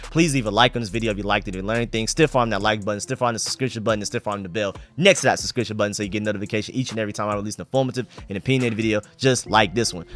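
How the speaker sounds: normal talking voice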